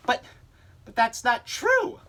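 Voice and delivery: high, slow, outraged objection; can't believe the lie; shocked protest. Acted.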